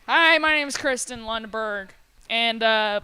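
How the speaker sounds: ordinary talk, nothing marked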